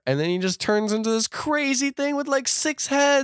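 climbing to a very high squeal; comically overwhelmed by the absurdity; incredulous excitement. Real, not acted. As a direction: stretch the ending out in disbelief. Second absurd transformation.

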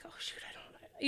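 unvoiced whisper to herself; embarrassed whisper, not remembering them; whispered aside.

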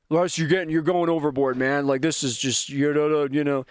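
a lightly voiced listener teasing him; mock critic telling him he's gone overboard; imagined heckler. Listener heckling the host about going overboard, lightly voiced. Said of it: "welcome to the Russ Rants podcast".

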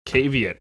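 "caveat" said KAY-vee-ut; long "ay" at the start of "caveat"; mispronounced as spelt. Shown as a demonstration. Showing a friend how it looks, "caveat" as KAY-vee-ut. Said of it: "It looks like it says caveat".

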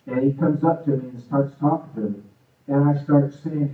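ordinary delivery

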